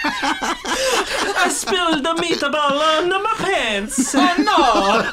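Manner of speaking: high, slow wailing cartoon Italian accent. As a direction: lament it in a hammy squealed Italian accent. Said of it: "he comes out and he's like".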